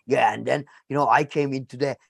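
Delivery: Minnesota accent pitched well down, imitating Ventura; deep Minnesotan accent. Retelling Jesse Ventura's debate story in a low Minnesota accent. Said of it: "that deep Minnesotan accent".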